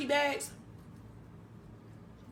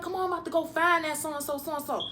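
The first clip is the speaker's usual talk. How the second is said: fast, higher imitation of a fired-up friend; riled up; 5 semitones higher than usual. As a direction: act out hot-headed urgency, rushing. Retold friend wanting to hunt gunman.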